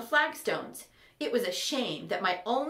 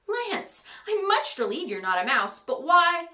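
excited relief; 7 semitones higher, 5 dB louder than usual. Acted. Ember relieved to find Lance.

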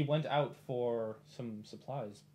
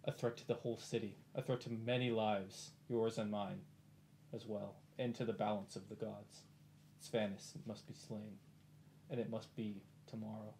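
a hint of a grave hero, quieter and measured; solemn warning; 4 semitones lower, 7 dB quieter than usual. Player character urges Arano that Svanus must die, lightly solemn. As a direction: speak it like a solemn plea.

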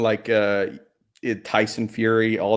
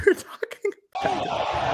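laughing. Real, not acted. Laughs at own joke.

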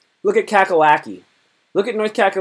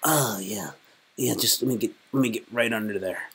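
low, soft creepy murmur; mock-lewd Virginia; 5 semitones lower, 8 dB quieter than usual. Streamer voicing Virginia cozying up to its neighbor, mock-lewd murmur. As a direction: murmur it low, as if sneaking in close. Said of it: "Virginia's like this".

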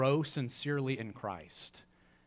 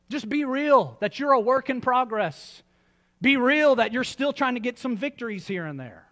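fervent preaching; 8 semitones higher, 5 dB louder than usual. Acted. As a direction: drive it home from the pulpit. Preaching climax.

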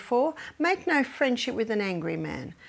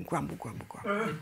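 grumbling; 4 semitones lower, 8 dB quieter than usual. Retold or imitated. Jealous boys mimicked.

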